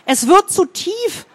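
high, slow urgent warning. A narrator's reading. Boy warning his friend in the river, urgent warning. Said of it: "warnte er seinen Freund".

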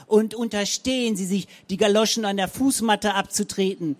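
yelling. A narrator's reading. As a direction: keep yelling after her.

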